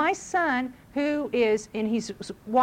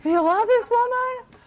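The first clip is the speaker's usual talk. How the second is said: high, slow, much louder gushing question; mock amazement; 11 semitones higher, 7 dB louder, slower than usual. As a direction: act amazed and delighted. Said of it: "I'd be like".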